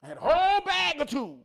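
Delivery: loud, high, driving preacher's cry; fired-up preaching. Acted. Bragging to the crowd about his tools, at full preaching force. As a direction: build it up like a revival shout. Returns to the tool bag boast.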